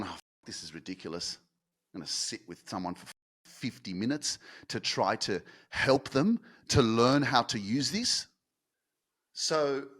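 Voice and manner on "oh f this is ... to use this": a low, much softer, dismissive grumble; impatient scoffing. Retold or imitated. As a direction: scoff as if you can't be bothered to help. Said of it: "someone without that kind of patience would have gone".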